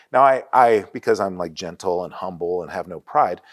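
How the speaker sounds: usual speaking voice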